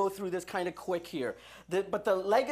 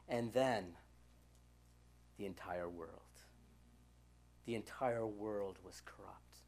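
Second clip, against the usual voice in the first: hushed; 12 semitones lower, 13 dB quieter than usual. Real, not acted. Preacher telling the church the whole world was corrupt, hushed and grave. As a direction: let it sink to a low, heavy hush. Climax of the serpent's legacy.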